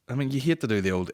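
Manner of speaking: usual speaking voice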